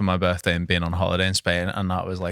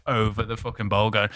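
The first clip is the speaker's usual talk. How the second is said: ordinary delivery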